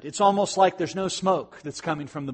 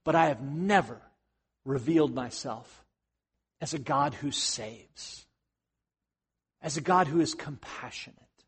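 soft, hushed God, much softer and lower; God softly revealing his saving nature; tender God; 3 semitones lower, 7 dB quieter than usual. Acted. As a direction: gently promise compassion. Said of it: "God says".

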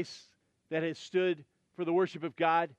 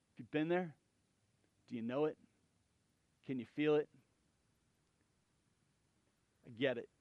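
tender, hushed; 5 semitones lower, 7 dB quieter, faster than usual. Real, not acted. Pastor quietly reaching out to grieving listeners. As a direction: speak gently to someone who has lost everything.

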